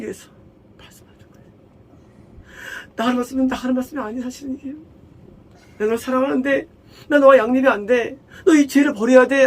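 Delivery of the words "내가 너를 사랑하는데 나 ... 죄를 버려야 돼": higher, louder, voice breaking into tears; God begging his child to leave sin; pleading God. Acted. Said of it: "라고 우리가 말씀". (울먹이며 간청하는 하나님 목소리)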